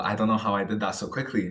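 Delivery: ordinary talk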